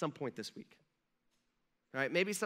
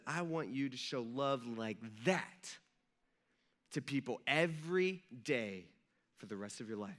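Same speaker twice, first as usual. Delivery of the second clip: gentle charge; 5 semitones lower, 4 dB quieter than usual. Pretend. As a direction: lay it down tenderly, word by word. Jesus repeats the charge.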